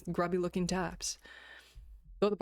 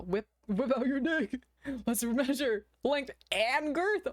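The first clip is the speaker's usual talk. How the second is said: character voice; 7 semitones higher, slower than usual. Acted. Voiced line from the story.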